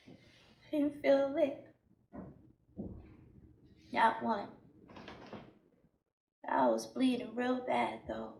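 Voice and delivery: low, subdued, spent; numb aftermath. Acted. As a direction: drop to a quiet, detached murmur. Numb after hitting herself.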